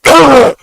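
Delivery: high, loud mocking groan of disgust; mock disgusted ugh. Mimicking people who dislike wearing masks, a loud disgusted ugh. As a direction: sneer it out like a grossed-out mask wearer. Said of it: "and they're like".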